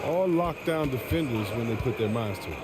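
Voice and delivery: ordinary talk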